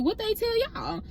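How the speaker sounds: can't believe she was left out; shocked question. Retold or imitated. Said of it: "I'm like".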